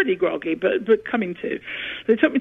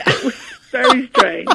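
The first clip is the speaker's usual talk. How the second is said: high, loud laughing speech; amused, breaking into laughter at her own story; real laughter; 9 semitones higher, 4 dB louder than usual. Real, not acted. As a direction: laugh through the words.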